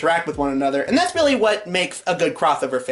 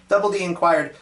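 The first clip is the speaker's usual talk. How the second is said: usual speaking voice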